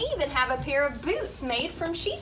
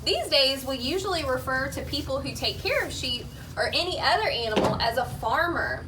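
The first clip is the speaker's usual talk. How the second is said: ordinary talk, nothing marked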